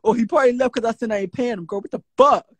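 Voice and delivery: usual speaking voice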